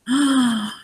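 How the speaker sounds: a quick, amazed gasp. Acted. Reader gasps for children at the surprise, amazed. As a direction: gasp as if seeing something wonderful.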